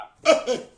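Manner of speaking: sudden loud, high-pitched laugh; real laugh. Real, not acted. Listener reacting to the other caller's talk, laughing. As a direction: burst out laughing.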